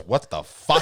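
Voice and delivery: loud, incredulous exclamation; mock her shocked outburst; retold outburst. Retold girlfriend's outburst at the pine cones.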